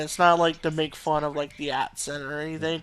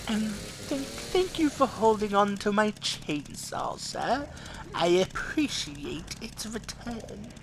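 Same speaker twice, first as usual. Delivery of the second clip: high, slow, soft mock-polite character voice; silly high-pitched accented Leon parody; joke accent voice; 5 semitones higher, 4 dB quieter, slower than usual. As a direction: put on a high, drawn-out comic accent. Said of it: "Imagine if Leon talked like that".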